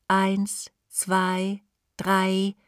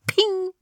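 high sung ding, falling off; ping like a lift bell; 12 semitones higher than usual. A narrator's reading. Aufzug hält, Klingelton nachgemacht. (hoher Aufzugs-Pling)

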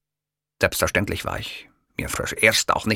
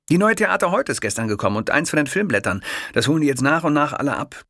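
fast, higher chatter; chatty kiosk man; 7 semitones higher than usual. A narrator's reading. Kiosk man planning to show off Hitler, brisk and chatty. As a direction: rattle off the plan.

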